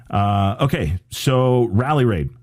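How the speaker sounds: usual speaking voice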